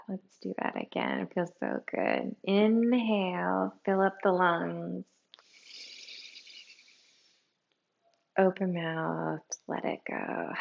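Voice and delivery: soft, unhurried meditation voice; guided breathing. Real, not acted. Teacher leading listeners through another inhale, softly and calmly. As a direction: guide the breath gently, almost sighing the last words.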